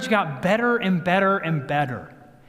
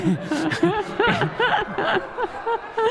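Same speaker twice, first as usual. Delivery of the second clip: high, long burst of laughter; real laugh. Real, not acted. Joking that one crazy spouse is easier, real laughing.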